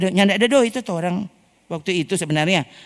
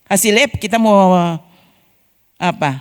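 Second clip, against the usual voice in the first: usual speaking voice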